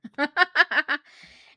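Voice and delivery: loud bursting laugh. Real, not acted. Laughing at the TikTok bear story. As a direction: crack up at the punchline.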